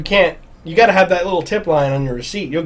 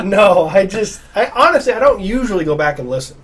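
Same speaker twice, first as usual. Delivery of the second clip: ordinary delivery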